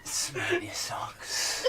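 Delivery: drawn-out mock-creepy whisper; stretched, breathy whisper for a laugh; whispered tease. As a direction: whisper it slowly, teasing.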